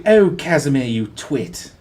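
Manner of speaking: posh British put-down, dropping low at the end; snotty posh British. Acted. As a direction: sneer it like the Queen's English. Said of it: "Oh, she's so snotty".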